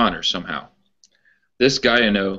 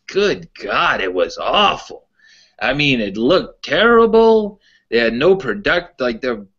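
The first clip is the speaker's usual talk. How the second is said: put-on a lightly done Pittsburgh old-timer accent, higher; playful take on an old Pittsburgh wrestling fan's accent, pitched up; imitating Pittsburgh accent; 4 semitones higher than usual. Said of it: "I'll try to do his accent".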